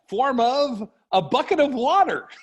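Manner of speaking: high, drawn-out superhero catchphrase; mock-heroic transformation cry; Wonder Twins activation call. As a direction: call it out big and high, stretching the words.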